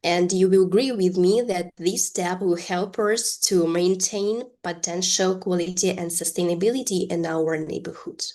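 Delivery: ordinary talk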